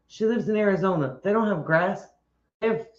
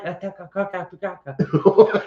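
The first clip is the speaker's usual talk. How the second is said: stuttered string of "ka" syllables; mock garbled spelling. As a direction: rattle off nonsense ka-ka syllables. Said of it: "that's spelled like".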